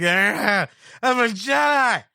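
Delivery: high, slow, dopey alien voice; slurring, dim-witted space creature; goofy drunken alien. Retold or imitated. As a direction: play a goofy drunk alien waving a lightsaber. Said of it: "goofy, drunken alien just waving the thing around".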